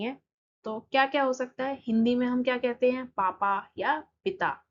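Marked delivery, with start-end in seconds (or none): none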